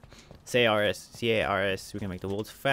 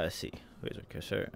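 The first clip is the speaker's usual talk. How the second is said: breathy whispered aside; 3 semitones lower, 10 dB quieter than usual. Real, not acted. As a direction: whisper it to yourself.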